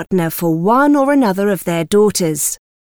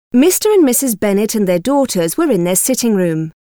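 ordinary delivery

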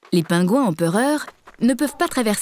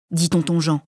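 ordinary delivery

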